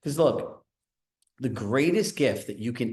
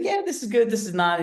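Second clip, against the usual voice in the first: much higher, quick verdict from the boss; blunt manager; 10 semitones higher, 3 dB louder than usual. Retold or imitated. Manager giving curt feedback on the training, in a much higher voice. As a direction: toss off the verdict like a busy boss. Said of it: "He's like".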